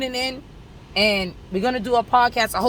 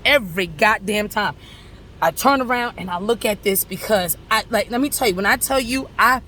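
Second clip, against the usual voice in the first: ordinary delivery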